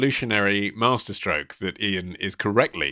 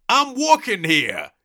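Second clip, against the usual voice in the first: aggrieved New Yorker, high and drawn out; bellowed New York accent; 12 semitones higher, 4 dB louder, slower than usual. Acted. Reading out a listener's question about New York impressions, aggrieved New York bellow. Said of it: "bellowing the phrase".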